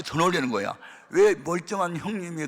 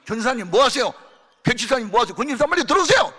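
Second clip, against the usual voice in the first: higher, louder urgent calls; calling out; 7 semitones higher, 7 dB louder than usual. Retold or imitated. Son calling the church members in, urgent. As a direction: hurry them in from outside.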